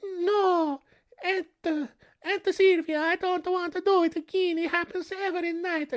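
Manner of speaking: high mock-crying child pleading; young Donato begs his aunt in a high mock-tearful voice; whining little boy. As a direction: play the boy whimpering to his aunt, hammed-up. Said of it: "It's just like".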